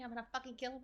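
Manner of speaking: soft muttered threat. Real, not acted. Streamer quietly threatening the photographer under her breath. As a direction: keep it under your breath.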